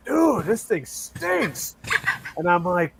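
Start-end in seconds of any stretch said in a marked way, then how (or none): 0.1-1.8 slow, high, disgusted dude; disgusted drawl. Retold or imitated. Salesman groaning to customer about the stinky guitar, slow and disgusted. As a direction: stretch it out like the smell is unbearable. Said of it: "and he goes".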